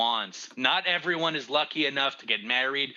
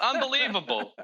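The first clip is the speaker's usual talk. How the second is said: exasperated; 12 semitones higher than usual. Real, not acted. Mock outrage at friend.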